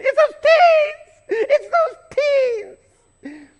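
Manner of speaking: very high, loud, slow, laughing shriek of a cleaning lady; cleaning lady's outburst. Retold or imitated. Preacher imitating the weeping cleaning lady bursting out, a very high loud laughing shriek. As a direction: squeal the words out high and loud through a laugh. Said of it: "she goes".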